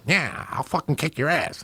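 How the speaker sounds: imitating a punch-drunk barfly threatening nobody; drunk old boxer.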